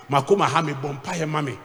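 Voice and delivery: usual speaking voice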